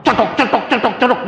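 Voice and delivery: high, rhythmic "cetok" clacks; imitated clacking teeth. Dentist retelling his fear for his teeth, rhythmic clacking imitation. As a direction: mimic teeth knocking in a steady beat.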